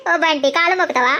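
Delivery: laughing through the words. Acted. Girlfriend laughs at lover's promise to touch her feet, laughing. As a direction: breaks into a laugh mid-sulk. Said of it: "నవ్వకు, నాకు భయం అవుతుంది నువ్వు నవ్వుతే".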